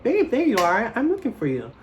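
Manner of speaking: put-on sweet girlfriend voice. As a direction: gush over him like you just found him. [name] greeting her boyfriend.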